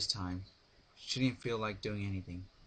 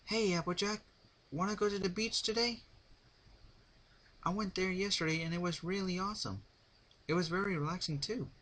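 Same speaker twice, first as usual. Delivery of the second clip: calling out; 10 semitones higher than usual. A narrator's reading. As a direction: call out to a friend in the barn. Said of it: "Rainbow yelled into the".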